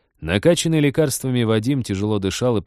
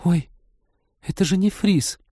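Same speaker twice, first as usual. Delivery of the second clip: much higher, stunned exclamation; shocked gasp; 9 semitones higher than usual. Acted. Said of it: "потрясённо ахнула".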